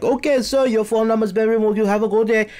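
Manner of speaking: high-pitched mimicry of the Indian-accented agent; high, mocking Indian-accented customer service voice; imitated phone agent. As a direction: brush the caller off in a put-on Indian accent.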